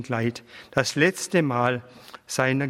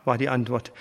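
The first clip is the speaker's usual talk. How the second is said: ordinary delivery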